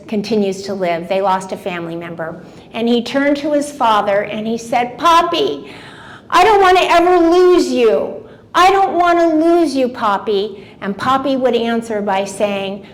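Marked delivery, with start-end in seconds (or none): 5.0-10.7 put-on child pleading. Child pleading with father, retold.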